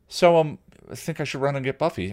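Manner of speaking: ordinary delivery, nothing marked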